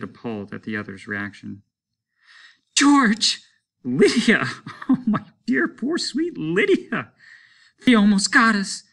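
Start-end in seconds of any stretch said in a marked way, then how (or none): 2.7-4.4 high, loud startled cries; panicked shouts. A narrator's reading. Both flee lions, shouting. 4.7-7.1 laughing. A narrator's reading. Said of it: "he was laughing". 7.9-8.8 high, loud shaken outburst; frightened cry. A narrator's reading. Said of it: "she was crying".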